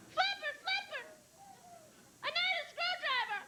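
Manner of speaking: put-on very high child's voice calling out; high childish plea to the dolphin; little boy calling. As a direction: cry out like a stranded little boy. Boy in boat calls dolphin.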